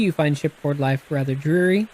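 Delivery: usual speaking voice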